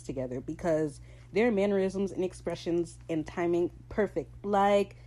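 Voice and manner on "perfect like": usual speaking voice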